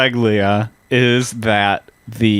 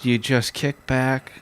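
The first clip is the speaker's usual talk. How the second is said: relaxation guide. Pretend.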